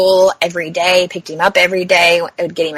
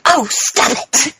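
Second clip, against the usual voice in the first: drawn-out, higher-pitched playful protest; mock scolding; 5 semitones higher, much slower than usual. Mom telling off her joking patient, mock scolding. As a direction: imitate an exasperated mom, slowly. Mom's reaction to the joke, imitated.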